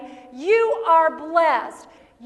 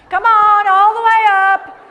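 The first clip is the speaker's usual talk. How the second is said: louder, higher rallying cry; loud call; 5 semitones higher, 13 dB louder than usual. Real, not acted. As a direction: shout it up to the crowd. Calling audience to raise hands.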